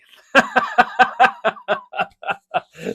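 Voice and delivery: a loud, high burst of laughter; big high laugh. Real, not acted. Laughing that the kids don't know his buttons. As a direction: crack up at the joke.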